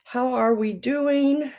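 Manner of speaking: cheery greeting. Real, not acted. Live stream greeting viewers.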